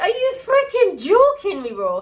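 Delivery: high, loud, slow outraged cry; mock outrage, high and loud; exasperated. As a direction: shout it at the lost luggage in disbelief. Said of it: "I'm like".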